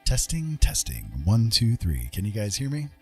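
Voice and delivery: ordinary talk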